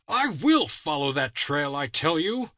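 high, loud furious shout; shouting with rage. Acted. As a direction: defy them at full voice. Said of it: "He shouted aloud with rage".

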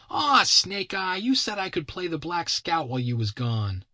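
put-on whining kid. Kid protests to his captor about playing scout, high whiny child voice. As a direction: plead like an indignant little boy.

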